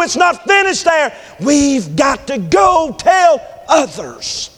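loud, high, drawn-out preacher's cry; slow, stretched shouts with falling endings; full-force preaching. Real, not acted. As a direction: cry each phrase out to the congregation.

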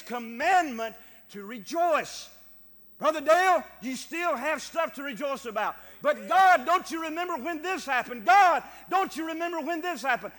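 high, loud, urgent pulpit cries; full-force preaching. Real, not acted. Preacher urging the church to remember and rejoice, shouted preaching. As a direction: build to a shouted command.

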